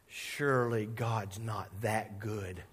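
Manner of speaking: slow, soft disbelieving aside; wry inner thought. Retold or imitated. Driver thinking to himself on seeing police lights, slow and soft. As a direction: mutter it to yourself, amazed.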